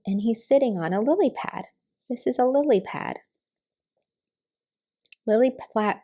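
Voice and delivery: plain reading voice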